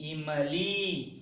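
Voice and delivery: इमली drawn out very slowly and softly; slow model word. Shown as a demonstration. Teacher drawing out इमली for children. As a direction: stretch इमली for pupils to repeat. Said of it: "यहाँ पर आप चित्र देख सकते हैं".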